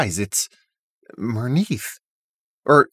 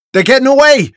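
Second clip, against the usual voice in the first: high, loud cry of alarm; urgent shout; 10 semitones higher, 8 dB louder, faster than usual. A narrator's reading. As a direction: shout it so the others give chase. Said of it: "Aaliyah shouts".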